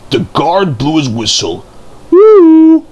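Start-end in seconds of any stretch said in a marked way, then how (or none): 2.1-2.8 a shrill, loud train toot; high loud toot. Acted. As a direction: toot like a little railcar setting off. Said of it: "tooted Daisy".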